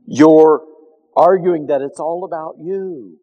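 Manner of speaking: ordinary talk, nothing marked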